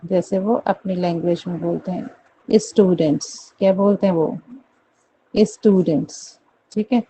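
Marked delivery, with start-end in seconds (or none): 2.5-3.5 UP-style "स्टूडेंट्स" as "is-tudents"; UP accent. Shown as a demonstration. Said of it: "हर स से पहले वो ई लगा देते हैं". 5.4-6.4 UP-style "स्टूडेंट्स" as "is-tudents"; UP accent. Shown as a demonstration. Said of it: "हर स से पहले वो ई लगा देते हैं".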